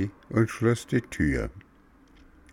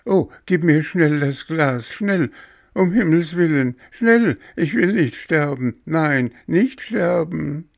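high, loud, panicky begging; acted panic, urgent and shrieky high; frantic pleading; 11 semitones higher, 6 dB louder than usual. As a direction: beg desperately for your life in a child's voice. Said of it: "flehte Bengele".

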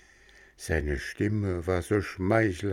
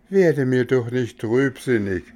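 higher, louder sweet coaxing; coaxing coachman; 5 semitones higher, 5 dB louder than usual. Acted. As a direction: cheer the sad boy up to lure him. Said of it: "sprach da das dicke Männchen".

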